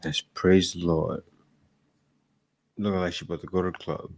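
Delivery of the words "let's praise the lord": slowed, much lower sarcastic repeat; mocking echo. Mocking the churchgoing vlogger with a slow, low sarcastic echo. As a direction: drag it out low to mock her.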